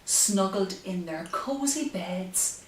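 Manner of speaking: gentle sing-song rhyme reading; reading to children. Acted. Bedtime reading the rhyme aloud to her baby. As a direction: read the rhyme like a lullaby.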